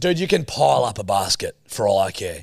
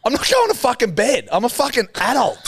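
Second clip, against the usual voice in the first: high, worked-up refusal; indignant drunk protest; 11 semitones higher than usual. Retold or imitated. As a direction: refuse like a defiant grown man. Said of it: "and I go".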